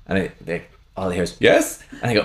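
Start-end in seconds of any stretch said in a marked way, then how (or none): 1.4-1.8 very high, eager questioning reply; comic high squeak of a reply; answering soldier. Acted. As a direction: pop up with a high-pitched answer. Enemy soldier answers the call.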